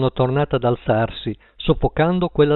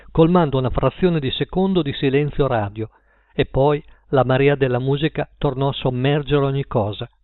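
usual speaking voice

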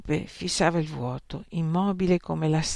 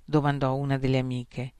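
plain reading voice

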